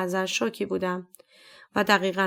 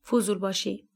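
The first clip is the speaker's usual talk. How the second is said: ordinary delivery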